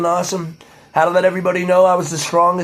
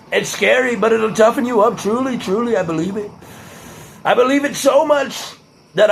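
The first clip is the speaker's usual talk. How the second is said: pitched-up presidential parody; higher, showy politician's cadence; Donald Trump impression; 4 semitones higher than usual. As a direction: ramble like a self-assured politician, leaning on superlatives. Trump-style superlatives, impression resumed.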